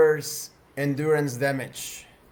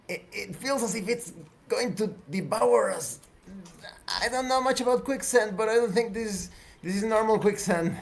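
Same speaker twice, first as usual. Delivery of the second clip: high, alarmed character voice; Arisa; 6 semitones higher than usual. Acted. Woman fears quicksand will devour them, high-pitched voice. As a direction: stammer in raised pitch without shouting. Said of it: "Arisa yells".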